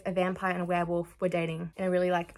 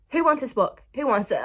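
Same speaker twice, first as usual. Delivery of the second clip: loud, high call for takers; mock auctioneer's call; 4 semitones higher, 6 dB louder than usual. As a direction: playfully call out for bidders.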